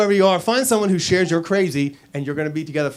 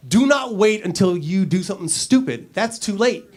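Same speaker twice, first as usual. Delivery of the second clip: ordinary delivery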